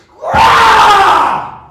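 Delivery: loud high roaring grah; executioner's battle cry. Retold or imitated. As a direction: let out a savage sword-swing yell. Executioner swinging a sword.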